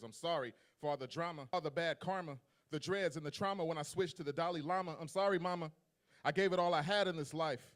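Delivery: rhythmic spoken-word delivery, building as it goes; slam poem performance. Acted. Poet performing an apology poem to his mother, spoken-word.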